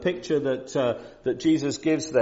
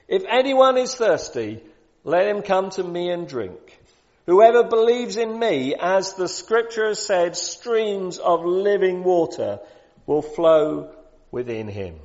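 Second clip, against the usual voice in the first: higher, louder proclamation, slowing at the end; loud cry; 8 semitones higher, 6 dB louder than usual. Acted. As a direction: cry it out to fifty thousand people. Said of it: "in a loud voice, cries out this".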